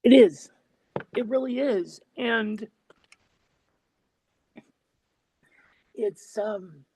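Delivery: high voice, close to tears; overcome, words trailing into long pauses; choked up. Real, not acted. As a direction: agree haltingly while holding back real tears. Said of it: "I'm getting a little choked up".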